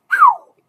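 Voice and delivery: high, loud whoosh; a sudden high-pitched whew like something taking off. Shown as a demonstration. Imitates going off to paradise.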